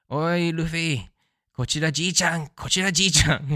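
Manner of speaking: hammed-up old man hailing his grandson; imitating Garp. Reviewer imitates Garp announcing himself to Luffy, hammed-up. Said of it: "how he announces himself to Luffy".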